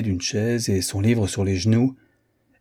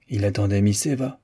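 ordinary delivery

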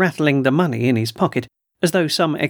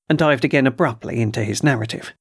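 ordinary delivery, nothing marked

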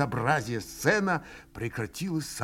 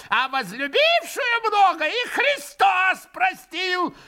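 high shrill mocking old man; ranting old man; 12 semitones higher than usual. A narrator's reading. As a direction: sneer and shriek at the monks. Old man rants at monks.

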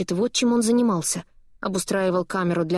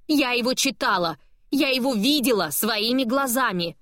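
higher, agitated outburst; agitated, raised voice; 6 semitones higher than usual. Acted. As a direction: raise the voice, upset and insistent. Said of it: "взволнованно повышает голос".

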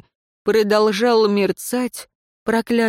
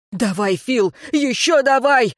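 urgent yell climbing high; frantic yelling; 6 semitones higher than usual. A narrator's reading. Tracker urges archer to shoot, yelling. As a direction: egg him on frantically. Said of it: "заорал следопыт".

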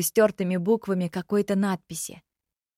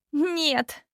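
firm refusal; 8 semitones higher than usual. Acted. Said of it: "решительно мотнула головой".

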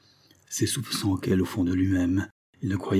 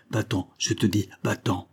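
plain reading voice

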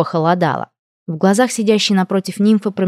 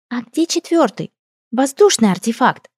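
curious princess voice; 6 semitones higher than usual. Acted. Princess asks a question.

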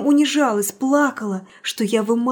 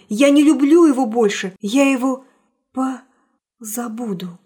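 voice breaking; 5 dB louder than usual. Acted. Breaking off in tears.

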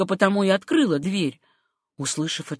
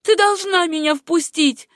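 high, insistent voice; acted insistence, pitched up; urgent demand; 10 semitones higher, 4 dB louder than usual. A narrator's reading. Acted quarrel, demanding to be let in.

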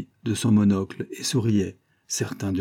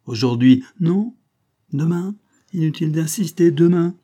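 firm refusal; 8 semitones higher, 7 dB louder than usual. Acted. Firm refusal in acted quarrel.

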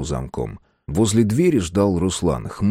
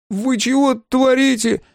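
high, strained, startled question; drawn-out, high-pitched nervous protest; voice jumping in alarm; 3 dB louder, slower than usual. A narrator's reading. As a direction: let the voice leap up in fright. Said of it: "спросил он прыгнувшим голосом".

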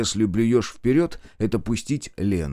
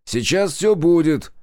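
loud exclamation; 7 semitones higher, 4 dB louder than usual. A narrator's reading. Crowd scene, loud promise.

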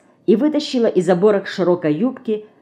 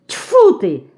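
exasperated exclamation, higher, louder; annoyed; 9 semitones higher, 4 dB louder than usual. A narrator's reading. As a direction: spit out your vexation. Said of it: "с досадой".

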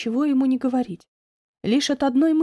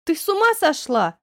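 higher, incredulous exclamation; acted indignant outburst at a friend; exclaiming; 5 semitones higher than usual. A narrator's reading. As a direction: cry out in disbelief. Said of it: "воскликнула она".

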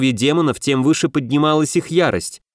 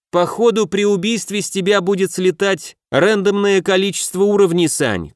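high-pitched call to a comrade; shouting; 7 semitones higher than usual. A narrator's reading. Max warning Sanya about lost levels, shouting. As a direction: yell the warning across, pitched well up. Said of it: "крикнул Макс".

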